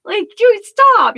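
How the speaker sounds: high indignant pet voice, building to a loud stop; cat's annoyed voice. Retold or imitated. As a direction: voice the fed-up cat pushing away. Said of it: "she's crawling off of me 'cause she's like".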